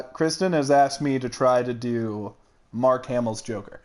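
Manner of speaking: normal talking voice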